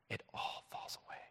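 breathy whispered close. Acted. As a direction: let it fall away in a whisper. Whispered sermon point.

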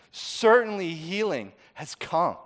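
slow refrain. Real, not acted.

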